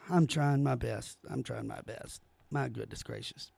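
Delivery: near-silent, defeated muttering to himself; exasperated mutter. Real, not acted. Fed-up commentator grumbling to himself after reading the quote, very soft. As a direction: sink right down and mutter it under your breath.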